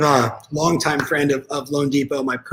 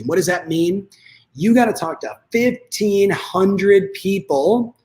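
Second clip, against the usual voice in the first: ordinary delivery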